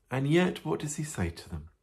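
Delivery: usual speaking voice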